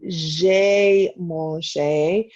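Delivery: "j'ai mangé" drawn out very slowly; slow model phrase. Shown as a demonstration. Teacher showing class the past tense, very slow. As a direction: stretch "j'ai mangé" as a clear example.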